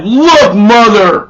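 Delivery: loud, high start of a curse; mock outburst. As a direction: start an angry retort and choke it off. Angry start of reply, cut off.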